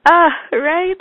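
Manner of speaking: high, loud laughing "ah"; delighted. Real, not acted. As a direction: laugh it out, touched. Delighted reaction.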